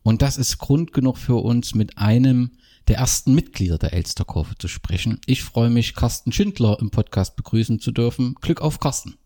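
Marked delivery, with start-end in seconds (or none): none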